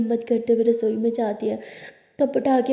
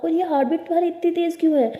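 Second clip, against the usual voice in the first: alarmed; 6 semitones higher, faster than usual. A narrator's reading. Siya alarmed at racing heartbeat.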